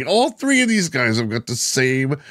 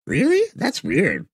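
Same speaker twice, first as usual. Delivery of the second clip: put-on much higher, squeaky girl voice; high girl voiceover. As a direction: pitch it way up like a girl. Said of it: "has the voiceover actors".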